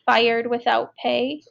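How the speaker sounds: plain reading voice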